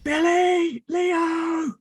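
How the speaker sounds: high, drawn-out call of two names; shouting. Acted. Player's character runs into the building calling for friends, shouting. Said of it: "and start shouting".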